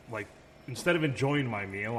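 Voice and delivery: ordinary delivery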